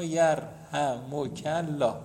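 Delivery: یرحمک الله said slowly, every syllable clear; model pronunciation. Shown as a demonstration. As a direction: spell out یرحمک الله slowly for students to copy. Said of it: "همین تلفظ کنید شما".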